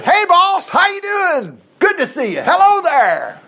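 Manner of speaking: high, loud, hammed-up cheeriness; cheerful greeting. Saul greeting Samuel after disobeying, loudly cheerful. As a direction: greet the boss with overblown good cheer. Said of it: "Saul greeted him cheerfully".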